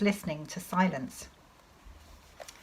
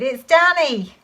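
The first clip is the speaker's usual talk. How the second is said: high, loud shout of who it is; shouted call; 8 semitones higher, 9 dB louder than usual. Acted. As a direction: call out so dad hears from afar. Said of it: "I shouted".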